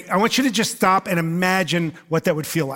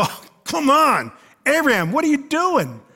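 exasperated; 7 semitones higher than usual. Pretend. Exasperated listener at Abraham.

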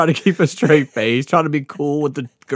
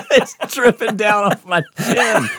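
high, slow, through laughter; real helpless laughter in the words; laughing; 7 semitones higher, slower than usual. Real, not acted. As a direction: barely get it out for laughing. Laughing with co-host at own story.

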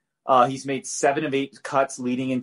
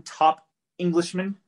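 light mock British accent, higher and slow; playful put-on British accent; 5 semitones higher than usual. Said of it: "I'll do a British accent".

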